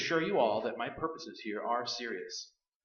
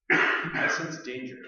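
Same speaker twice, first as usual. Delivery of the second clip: ominous; 3 semitones higher, 9 dB louder, much slower than usual. Acted. Psychic senses evil.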